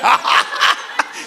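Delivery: loud shouting laugh; hearty cackling laugh at the joke; loud burst of laughter. Real, not acted. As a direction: let a big laugh explode. Big laugh after mother quote.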